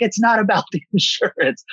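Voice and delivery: high, half-laughing protest; laughing through it. Retold or imitated. Patient laughing at staff about insurance, high. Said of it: "I'm like".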